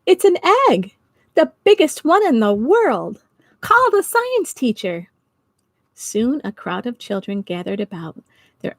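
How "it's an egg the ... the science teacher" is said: high, loud amazed shout; excited shout. Acted. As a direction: yell it to the children, high and loud. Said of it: "He shouted to some nearby children".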